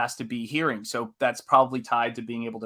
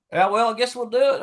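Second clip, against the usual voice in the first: put-on laid-back Tennessee accent, pitched up; 10 semitones higher, 4 dB louder than usual. Imitating Tennessee folk agreeing to a job, laid-back accent. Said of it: "the Tennessee accent".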